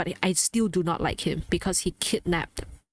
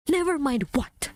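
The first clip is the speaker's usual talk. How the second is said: higher, exasperated challenge; indignant retort; 6 semitones higher than usual. Acted. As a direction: throw it back as a demand. Throws the dismissal back indignantly.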